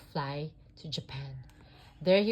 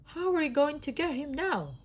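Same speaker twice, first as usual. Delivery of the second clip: worried question; 10 semitones higher, 5 dB louder than usual. A narrator's reading. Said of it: "Rex asked".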